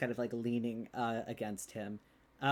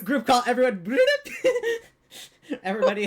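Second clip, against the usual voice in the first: laughing; 12 semitones higher, 13 dB louder, much faster than usual. Real, not acted. Laughing at group call.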